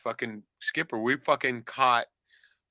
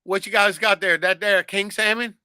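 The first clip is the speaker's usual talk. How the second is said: put-on a light Southern good-old-boy accent, slightly louder; 6 semitones higher, 5 dB louder than usual. Fisherman imitating the Southern man asking about the catch, light Southern accent. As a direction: ask it like a Southern dockside tourist in a mild accent. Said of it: "I just fucking grabbed his accent".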